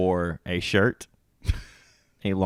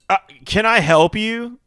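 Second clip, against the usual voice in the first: much higher, louder, prim lady voice; suspicious homeowner; 14 semitones higher, 10 dB louder than usual. Retold or imitated. Homeowner coming out to question the worker, high and prim. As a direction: play the wary homeowner. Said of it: "she's like".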